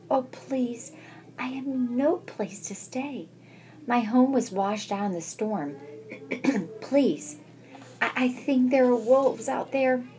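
high timid pleading mouse voice; pleading little mouse. Acted. Frightened mouse Mona begging.

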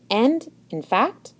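ordinary delivery

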